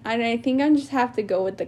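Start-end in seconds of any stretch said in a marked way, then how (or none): none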